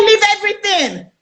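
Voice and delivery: high-pitched retold cry to drop it all; reenacted frantic order; urgent command. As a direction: pitch it way up, as if fleeing in a rush. Reenacts order to abandon belongings and run.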